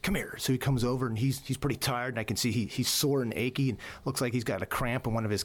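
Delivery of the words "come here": much higher, barked command; sharp drill-sergeant order. Retold or imitated. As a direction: snap it out like a sergeant. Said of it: "and I get".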